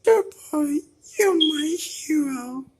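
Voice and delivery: squeaky high-pitched delight; high gushing character. Pretend. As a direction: swoon like a cartoon pet. Character gushes.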